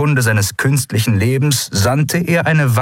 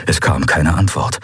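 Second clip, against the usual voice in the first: plain reading voice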